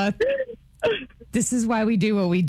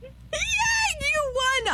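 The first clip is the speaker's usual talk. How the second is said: very high squealed cheer; real high-pitched whoop of congratulations; celebratory cheer; much slower than usual. Real, not acted. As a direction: squeal with delight for the winner. Host celebrates the winner.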